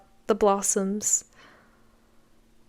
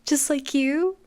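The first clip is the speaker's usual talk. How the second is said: put-on higher, eager boyish voice, rising to a thrilled cool; eager excitement; 6 semitones higher than usual. Blake imagines winning a medal, imitated, higher and eager. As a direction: play the kid dreaming of a medal. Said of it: "he's like".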